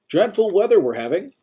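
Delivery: put-on bright, high, overly chipper old-timer voice; a busybody greeting a stranger; cheerful old man. A narrator's reading. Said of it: "that overly optimistic voice".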